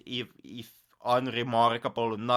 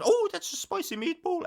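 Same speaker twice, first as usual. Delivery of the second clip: mock Italian accent, pitched way up; bad Italian accent. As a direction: ham up a cartoon Italian accent. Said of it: "absolutely atrociously bad Italian, accent".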